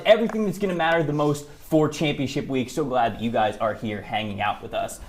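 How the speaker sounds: ordinary talk, nothing marked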